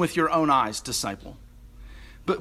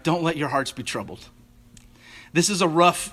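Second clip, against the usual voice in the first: ordinary delivery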